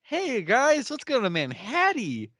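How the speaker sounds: loud, high hype-up of friends; rally the gang with hammed-up excitement; over-eager mock invitation, high and loud; mock enthusiasm. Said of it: "New York and be like".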